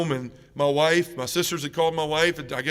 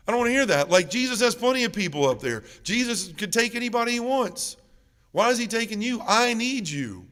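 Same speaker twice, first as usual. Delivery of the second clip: high, insistent refusal; desperate protest; 7 semitones higher than usual. Retold or imitated. Son refusing his mother's comfort, retold high desperate protest. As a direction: act out a pleading objection.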